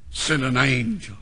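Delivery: ordinary talk, nothing marked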